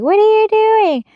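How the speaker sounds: high, drawn-out yell imitating outraged viewers; mock yell. Imagined viewers objecting to her painting the frame blue, mock yelling. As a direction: stretch it out in a high pretend holler. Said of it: "you're probably yelling at me like".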